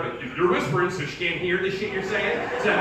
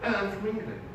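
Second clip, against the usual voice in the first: put-on understated English accent; 4 dB quieter than usual. Comedian claiming to be from England, English accent. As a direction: answer modestly in an English accent. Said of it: "that English accent".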